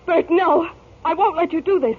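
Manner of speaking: desperate cry against the arrest; pleading protest. Acted. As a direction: beg them not to take him. Wife protests husband's arrest.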